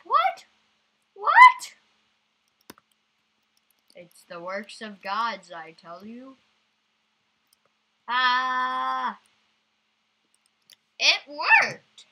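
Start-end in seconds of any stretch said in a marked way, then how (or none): 0.0-1.8 squealing high loud what; high shocked yelp. Real, not acted. Shock at game event. 8.1-12.1 loud high yell of victory; high, loud cheer; triumphant shout. Real, not acted. Game success triumph.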